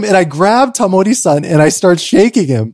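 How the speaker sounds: ordinary delivery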